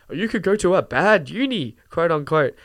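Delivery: usual speaking voice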